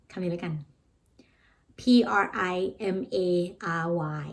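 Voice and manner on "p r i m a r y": ordinary delivery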